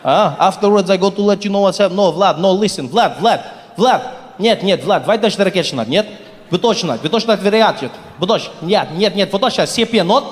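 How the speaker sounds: mock Russian phone call, fake Russian babble; Russian accent. Russian man setting up a deal on the phone in a mock Russian accent. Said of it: "and he was like".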